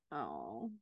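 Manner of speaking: moved by the mother's faith, a soft sigh; touched sigh. Real, not acted. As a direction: let out a moved little oh. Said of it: "makes me wanna cry".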